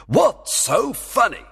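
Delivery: slow, high-pitched demand. A narrator's reading. Fairy king asks why Puck is laughing, demanding. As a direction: insist on knowing, irritated. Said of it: "demanded the king".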